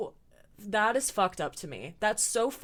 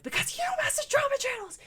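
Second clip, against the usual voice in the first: very high-pitched mock shriek; Shane's famous rant line; faster than usual. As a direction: mimic a hysterical crash-out, pitched way up. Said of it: "Shane gives the famous line".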